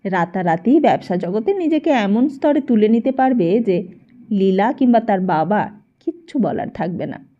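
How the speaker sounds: plain reading voice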